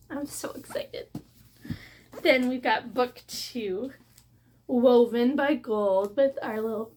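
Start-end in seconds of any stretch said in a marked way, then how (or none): 0.1-1.1 breathless whispered thrill; excited whisper. Real, not acted. Telling viewers her excitement in a whisper. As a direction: whisper it like a secret joy. After squealing at the books.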